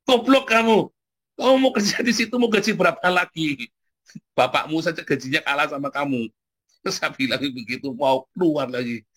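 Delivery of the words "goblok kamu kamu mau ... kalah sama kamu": scolding. Retold or imitated. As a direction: tell him off like a fed-up uncle. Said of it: "Tak marahi".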